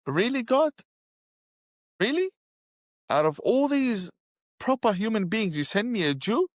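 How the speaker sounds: indignant disbelief. Retold or imitated. Retelling his past indignant reaction.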